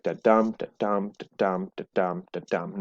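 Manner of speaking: quick unstressed "da", then land on "dum"; five even da-dum pairs, stressing every "dum"; iambic beat. Shown as a demonstration. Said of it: "followed by a stressed syllable. It sounds something like".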